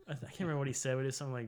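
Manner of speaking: ordinary delivery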